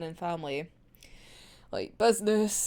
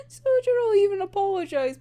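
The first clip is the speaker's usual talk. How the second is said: higher, louder, words cracking with laughter; laughing so hard it nearly cries; teary laughing; 12 semitones higher, 5 dB louder than usual. Real, not acted. Laughing while recounting scene.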